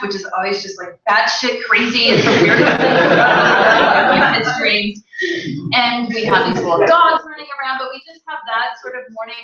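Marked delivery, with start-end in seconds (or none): none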